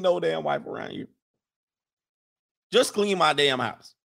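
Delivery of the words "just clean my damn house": ordinary talk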